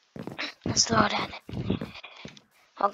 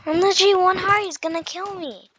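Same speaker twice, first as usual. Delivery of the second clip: high, loud panic; panicking gamer; 8 semitones higher, 6 dB louder, faster than usual. Real, not acted. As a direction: fear of dying in game. One heart left, chased.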